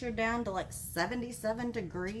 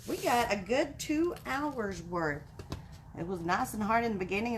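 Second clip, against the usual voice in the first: ordinary talk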